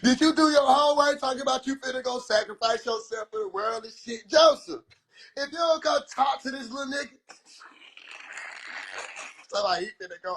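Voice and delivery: normal talking voice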